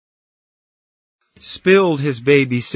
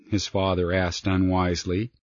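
usual speaking voice

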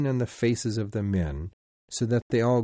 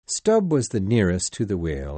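plain reading voice

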